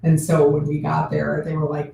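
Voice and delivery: ordinary talk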